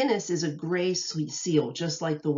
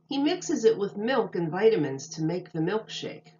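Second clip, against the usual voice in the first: bright, lilting read-aloud voice; storybook reading voice; reading to children; 3 semitones higher than usual. Acted. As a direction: read it like a picture book to little kids. Reads picture book to children.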